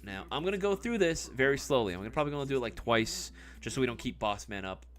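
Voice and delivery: ordinary talk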